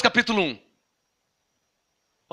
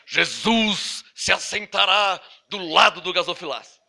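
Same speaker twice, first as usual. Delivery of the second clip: mock prophecy; 5 semitones lower, 4 dB quieter than usual. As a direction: intone it like a solemn prophet, tongue in cheek. (profeta solene de brincadeira)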